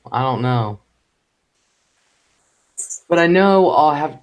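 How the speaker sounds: ordinary delivery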